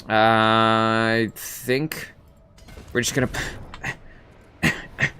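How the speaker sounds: strained voice, holding back a cough; talking carefully through painful coughs; coughing after surgery. Real, not acted. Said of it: "It hurts so much to cough".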